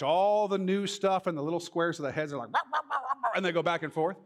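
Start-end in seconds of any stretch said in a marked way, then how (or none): 2.5-3.3 mock news squabble. Imitating arguing news heads.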